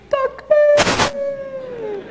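very high, ringing takbir call. Acted. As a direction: call it out high to rouse a crowd. Calling out takbir at a graduation.

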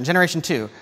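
ordinary talk

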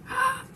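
sharp high gasp. Real, not acted. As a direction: gasp in shock. Narrator reacts to Maisie leaving.

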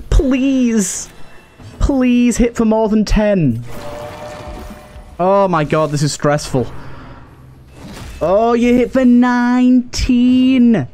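ordinary delivery

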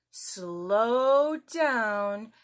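ordinary delivery

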